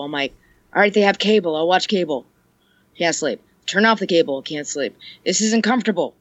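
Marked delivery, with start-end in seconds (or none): none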